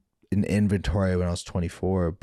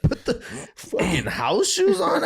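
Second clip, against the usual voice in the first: incredulous. Retold or imitated. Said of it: "I was like".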